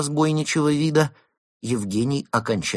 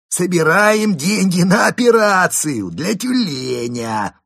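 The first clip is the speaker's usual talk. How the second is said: high, loud, slow insistence; raised voice; 11 semitones higher, 9 dB louder, slower than usual. A narrator's reading. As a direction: drive the point home to the friends. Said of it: "повысил голос Карамп".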